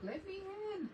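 high, tiny put-on puppy voice begging at the door; the dog whining to be let in; dog's voice. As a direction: voice the dog pleading to come in. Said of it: "I can hear Marshmallow out there walking".

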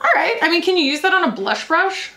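a high, dubious question to herself; high skeptical voice. Retold or imitated. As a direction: ask it in a high, doubtful voice. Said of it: "I was like".

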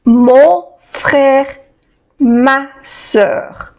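normal talking voice

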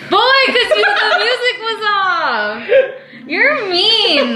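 high-pitched, whiny complaint through laughter; laughing protest. Real, not acted. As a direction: whine at being tricked, half laughing. Protests music was off, laughing.

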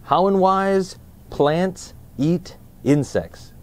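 drawn-out, lifted title read; title announcement. Shown as a demonstration. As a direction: present the title like a show host. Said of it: "Let's find out more in".

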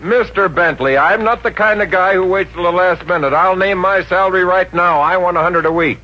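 name your price like a big shot; cocky demand. Acted.

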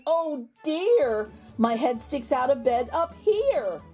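ordinary delivery, nothing marked

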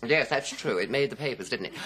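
ordinary talk

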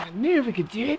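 lightly mocking high-pitched echo; mimicking game character. Player parroting the game's cheer, lightly mocking mimicry.

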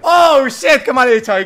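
very high, full-force frustrated scream; anguished yelling. Real, not acted. Gamer just killed in his match, anguished yelling. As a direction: scream in dismay at your own blunder.

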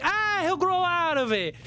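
high-pitched, drawn-out dismissive parent. Acted. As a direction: brush it off as an indulgent parent.

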